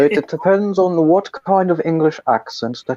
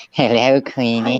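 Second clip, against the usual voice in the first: Peter Lorre; 7 semitones lower than usual. Acted. Friend greets Queenie as a film villain, Peter Lorre impression. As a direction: croon it low like a sinister henchman. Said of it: "it's Peter Lorre".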